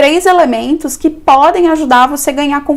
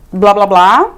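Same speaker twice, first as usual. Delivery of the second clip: ordinary delivery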